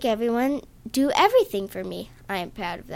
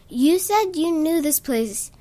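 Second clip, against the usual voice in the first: angry yell; 5 semitones higher, 4 dB louder than usual. A narrator's reading. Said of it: "I yelled angrily".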